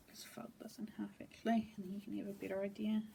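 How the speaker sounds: soft, quick whispered aside. Real, not acted. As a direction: murmur it under your breath while folding the fabric. Quiet aside while handling fabric.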